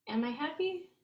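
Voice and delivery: "Am I happy" lifting up on "happy"; inflected question. Shown as a demonstration. Teacher showing how English asks questions, rising tune. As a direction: raise the pitch at the end as a question. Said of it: "we just tend to inflect".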